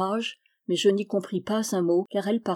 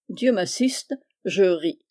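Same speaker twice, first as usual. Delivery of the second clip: ordinary delivery, nothing marked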